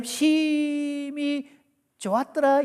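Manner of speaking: 심히 held very long and high, then a pause; drawn-out cue. Shown as a demonstration. Preacher cues a high-five, 심히 drawn out high. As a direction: stretch 심히 high before releasing 좋았더라. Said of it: "좋았더라는 순간에".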